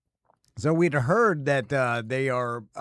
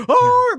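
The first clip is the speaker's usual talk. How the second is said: mock freak-out gasp; 5 dB louder than usual. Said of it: "like, freak out".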